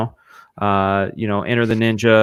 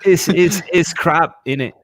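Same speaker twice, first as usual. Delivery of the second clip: amateur British accent, pitched up; 6 semitones higher, 3 dB louder than usual. Shown as a demonstration. Co-host asks to hear it again, attempted British accent. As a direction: have a go at a British accent, higher than usual. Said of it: "I could try to do a British accent".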